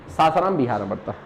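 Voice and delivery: usual speaking voice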